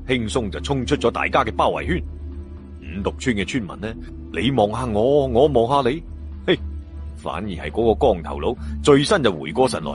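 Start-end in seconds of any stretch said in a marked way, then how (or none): none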